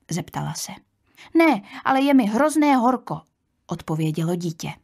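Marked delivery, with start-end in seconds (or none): 1.4-3.2 put-on a slightly higher child's reply; child complaining a little, pitched up; lightly voiced child. Tired little girl answers her aunt, lightly childlike complaint.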